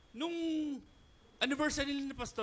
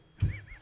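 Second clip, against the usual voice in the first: high squeal of laughter; 6 dB louder than usual. Real, not acted. Laughs at own joke.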